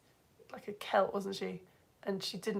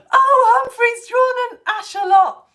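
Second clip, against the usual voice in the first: delighted squeal; 10 semitones higher, 10 dB louder, slower than usual. Real, not acted. Sees a viewer's axolotl drawing.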